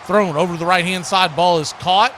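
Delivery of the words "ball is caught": ordinary talk, nothing marked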